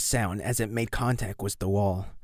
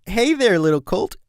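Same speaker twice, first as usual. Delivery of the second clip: forced friendly; 7 semitones higher, 5 dB louder than usual. Acted. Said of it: "tried her best to put on a friendly smile".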